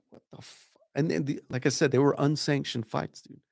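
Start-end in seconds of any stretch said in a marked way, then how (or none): 0.1-0.8 breathy whispered half-curse; stunned whisper. Retold or imitated. Watching a bleeding fighter, a stunned whisper. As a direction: whisper it like you can't believe the blood. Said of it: "and we're like".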